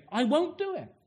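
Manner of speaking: high, insistent protest; Peter protesting. Retold or imitated. Peter insisting to Jesus, high protest. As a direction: insist you won't. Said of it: "Peter's saying".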